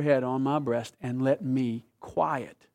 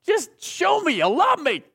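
much higher, slower pleading voice; pleading high cry; 13 semitones higher, 4 dB louder than usual. Acted. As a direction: beg to be loved, high and stretched. Husband voicing a longing plea.

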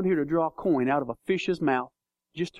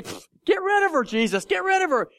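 higher, dismissive scoff; scoffing disciples; 11 semitones higher than usual. Retold or imitated. Disciples telling Jesus to dismiss her, scornful. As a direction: brush her off with a pfft. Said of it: "the disciples say".

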